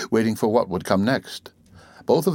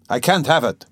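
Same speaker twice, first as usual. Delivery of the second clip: higher-pitched, insistent irritable refusal; exasperated, more forceful protest; irritable repetition; 6 semitones higher, 4 dB louder than usual. A narrator's reading. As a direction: push it out, irritated and rising. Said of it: "he repeated irritably".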